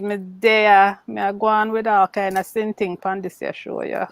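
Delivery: light Jamaican patois, half joking; Jamaican accent. Pretend. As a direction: keep the teasing Jamaican accent going. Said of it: "Your Jamaican accent having you".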